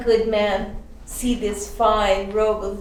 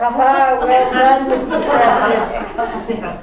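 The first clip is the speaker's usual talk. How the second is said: a much louder, delighted shout; delighted cheer; 9 dB louder than usual. Acted. Bob cheers his wife's right guess, delighted and loud.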